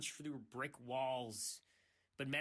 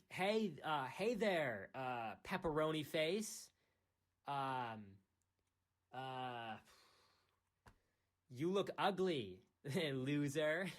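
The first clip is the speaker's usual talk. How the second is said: slow, drawn-out schoolyard taunt, a bit louder; hammed-up teasing insult; mock taunt; 4 dB louder, much slower than usual. Taunting an imagined listener with a slow mock insult.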